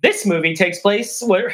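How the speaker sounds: ordinary delivery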